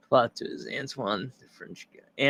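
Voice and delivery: usual speaking voice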